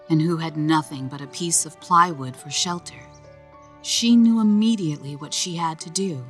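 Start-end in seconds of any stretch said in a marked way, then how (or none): none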